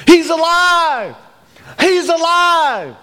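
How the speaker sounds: high, drawn-out jubilant calls; echoing cry. Retold or imitated. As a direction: let each cry ring out slowly through the house. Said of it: "imagine the echoes of that".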